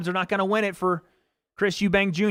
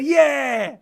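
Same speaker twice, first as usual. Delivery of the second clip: mock yell; 4 semitones higher than usual. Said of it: "looked across and said".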